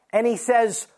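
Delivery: ordinary delivery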